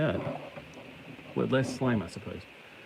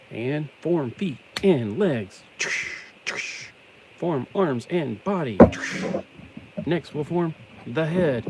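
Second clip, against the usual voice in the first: cartoon announcer; 6 semitones higher, 4 dB louder, slower than usual. Voltron intro announcer.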